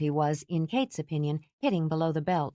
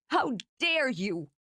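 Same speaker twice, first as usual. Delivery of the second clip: furious; 8 semitones higher, slower than usual. Acted. Furious after punch.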